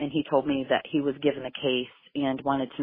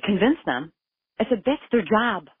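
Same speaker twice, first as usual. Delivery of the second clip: higher, louder, disbelieving; incredulous anger; 7 semitones higher, 6 dB louder than usual. Retold or imitated. Mother rejecting investigator's excuse, loud incredulous anger. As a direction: throw the words back in disbelief.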